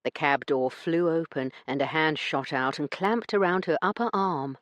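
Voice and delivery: ordinary talk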